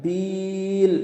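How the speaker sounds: বিল drawn long, three counts; three-beat stretch. Shown as a demonstration. Teacher chanting বিল with a three-count stretch. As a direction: hold বিল three beats. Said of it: "বিলে তিন আলিফ টান দিব".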